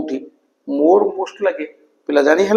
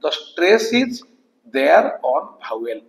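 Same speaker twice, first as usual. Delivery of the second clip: slow dictation; much slower than usual. Shown as a demonstration. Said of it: "କାରଣ".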